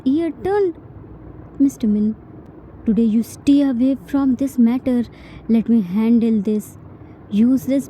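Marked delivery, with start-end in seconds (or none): none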